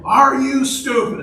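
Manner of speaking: high, slow mock yell; doubled-down yell, imitated. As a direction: imitate a woman hollering an insult. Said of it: "she doubled down and did it again".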